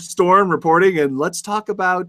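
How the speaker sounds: high shouted storm report; yelling over hurricane winds. Pretend. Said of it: "I'm in the eye of the storm reporting".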